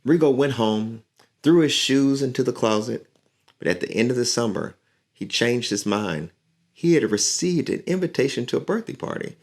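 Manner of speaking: lively storybook reading; reading to children. A narrator's reading. Teacher reading a picture book to her class, lively. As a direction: read the story aloud to young listeners.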